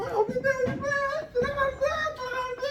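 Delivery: squeaky mock-student voice. Teacher voices a student finding it.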